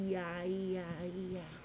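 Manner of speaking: low, very soft comforting murmur; soothing. Pretend. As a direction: croon quietly to settle a crying doll. Soothing a crying doll.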